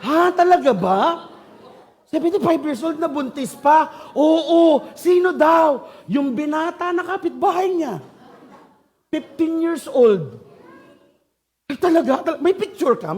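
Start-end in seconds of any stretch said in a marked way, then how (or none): 0.0-5.9 higher, louder gasping surprise; shocked gossip. Acted. Gossip listener reacts to scandal, loud shocked surprise. 11.7-13.2 higher, eager disbelief; eager gossip surprise. Acted. Gossip listener demands a picture, eager surprise.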